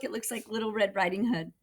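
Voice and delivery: plain reading voice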